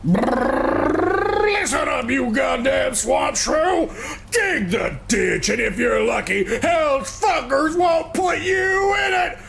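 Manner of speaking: shouted American biker threat with a brr growl; American biker accent. Acted. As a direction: snarl it like a rough American biker thug. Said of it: "In that same accent?".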